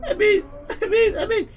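tearful laughing sobs; crying. Acted. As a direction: laugh and cry through the words. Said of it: "Don't cry".

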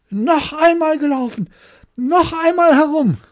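very high, very loud enraged yell; furious scream. Acted. Hare screaming at the hedgehog, beside himself with fury. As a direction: scream it, beside yourself with rage. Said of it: "außer sich vor Ärger und schrie".

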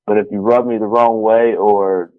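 normal talking voice

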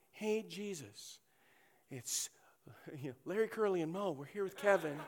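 soft, hesitant request; quiet apologetic message to Jesus; sheepish note. Acted.

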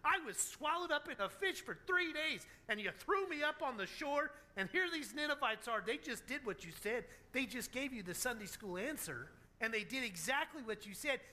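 high-pitched indignant rant, acted out; mock-outraged protest, rattled off without a let-up; mad at God. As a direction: play a tantrum of outraged complaint. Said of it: "Jonah gets mad at God and says".

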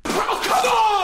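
high, frustrated outburst; radio host loses it at the wrong answer; exasperated shout. Acted. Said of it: "I'm so sorry".

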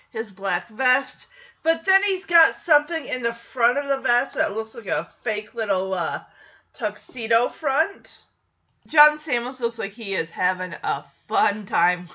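ordinary talk

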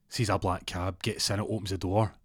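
normal talking voice

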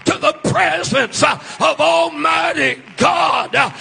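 loud, sing-song preaching with an uh after each phrase; full-force preaching. Acted. Preacher to his congregation, shouting sermon. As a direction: build the fire, shouting to the church.

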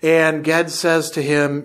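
usual speaking voice

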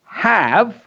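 "have" sounded fully and high; clear have. Shown as a demonstration. Teacher pronouncing "have" very clearly to children. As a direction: lift and stretch "have". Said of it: "pronounce that word".

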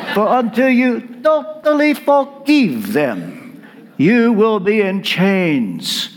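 fond imitation of a Romanian friend's accent. Preacher imitating his Romanian friend, in a Romanian accent. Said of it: "in his Romanian accent".

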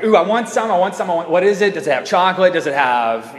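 put-on high, eager kid begging for some; excited child firing off questions. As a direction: burst out like a kid thrilled about dessert. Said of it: "they get all excited".

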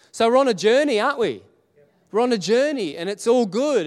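rally the room warmly; preaching. Acted.

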